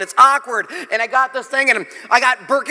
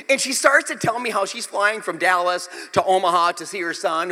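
ordinary talk

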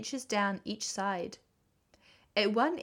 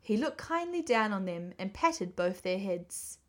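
ordinary delivery

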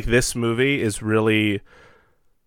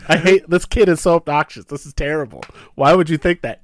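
gerutu kesal; 5 semitones higher, 4 dB louder than usual. Acted. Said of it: "I imagine him irritated".

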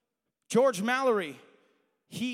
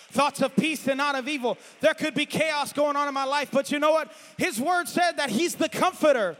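builds higher and louder into full preaching; swelling pulpit fervor; rising preaching; 5 semitones higher, 8 dB louder than usual. Acted. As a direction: lift the congregation as you climb.

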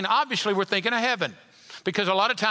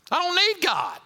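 higher, brash mock boast; mock proud boast; 9 semitones higher than usual. Preacher mimics a prideful man to congregation, brash boast. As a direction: play the proud man scoffing at God. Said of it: "You got a lot of pride".

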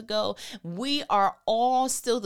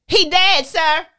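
much louder, flat-out blunt; blunt loud declaration; 7 semitones higher, 13 dB louder, slower than usual. Pretend. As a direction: drop the news hard on the disciples. Jesus telling disciples bluntly.

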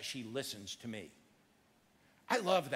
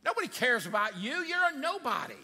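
scornful crowd; 6 semitones higher, 5 dB louder than usual. Acted. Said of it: "What did the crowd say?".

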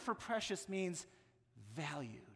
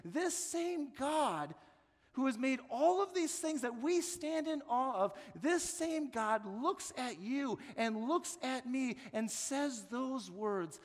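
higher, louder, swelling with awe; building preaching; 7 semitones higher, 5 dB louder than usual. Real, not acted. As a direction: preach it with rising wonder. Rising preaching passage.

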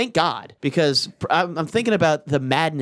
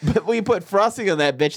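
ordinary delivery

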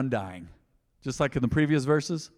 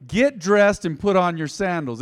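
higher, louder, slow measured order; angel's command; 4 semitones higher, 6 dB louder than usual. Acted. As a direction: order him up, word by word. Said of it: "Then the angel told him".

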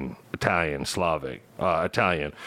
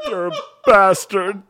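high, loud, through real laughter; laughing insult; 12 semitones higher, 8 dB louder than usual. Real, not acted. Host reacting to co-host's adoption jab, laughing insult. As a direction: blurt it out while cracking up.

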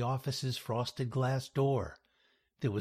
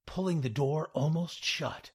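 plain reading voice

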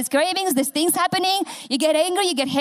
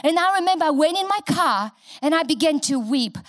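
ordinary talk, nothing marked

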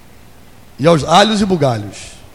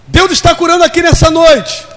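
high, loud proclamation; preaching proclamation; 10 semitones higher, 5 dB louder than usual. Real, not acted. Preacher announces God's healing to the church, loud and high.

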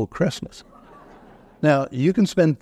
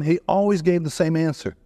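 ordinary delivery

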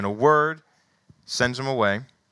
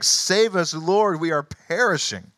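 frightened plea; 7 semitones higher, 5 dB louder, slower than usual. Acted. Disciples cry out in storm.

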